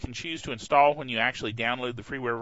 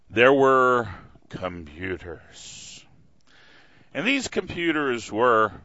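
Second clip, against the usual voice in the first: mock epic storytelling; 5 dB louder, slower than usual. Storyteller's once-upon-a-time build.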